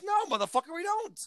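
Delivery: fake-snap at the customer; mock angry retort. Mock reply to demanding customer.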